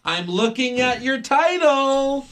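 high, slow put-on voice; mocking Kairi Sane.

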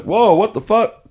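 high, loud startled whoa; shocked whoa. Retold or imitated. Power guy startled by a blinding foyer light, loud high shock.

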